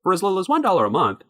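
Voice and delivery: ordinary delivery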